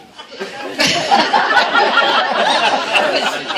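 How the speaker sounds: a long, loud, breathy burst of laughter; real laughter breaking out mid-story; big laugh. Real, not acted. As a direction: let the laugh take over completely. Big laugh remembering Don.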